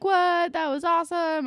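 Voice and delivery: high, loud stunned cry of delight; amazed shriek. Retold or imitated. Paige and Hazel react to Terry's comeback, high loud amazed delight. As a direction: gasp it out, thrilled and stunned.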